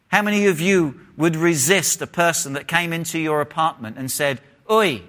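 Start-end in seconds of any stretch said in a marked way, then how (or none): 4.7-5.0 short, high London "oi"; high-pitched London-accented call. Acted. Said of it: "He's from London, by the way".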